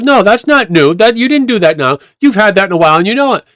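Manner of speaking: high-pitched retold contradiction; firm rebuttal. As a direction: imitate a man calling out a false accusation. Man refutes the accusation.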